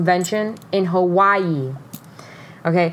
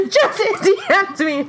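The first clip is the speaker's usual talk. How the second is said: high, slow, squealed through laughter; giddy re-enacted squeal; laughing excitement; 12 semitones higher, slower than usual. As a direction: spill big news while cracking up. Re-enacting excited news to mom.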